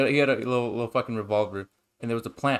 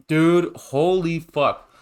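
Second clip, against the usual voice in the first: real amazement; 5 semitones higher, 5 dB louder, much slower than usual. Real, not acted. Reacting to a TV plot twist.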